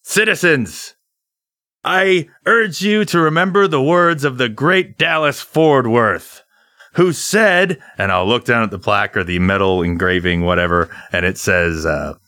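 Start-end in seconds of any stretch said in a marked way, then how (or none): 0.0-7.8 grand speech to crowd. Acted.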